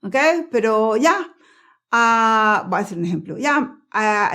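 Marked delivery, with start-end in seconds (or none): none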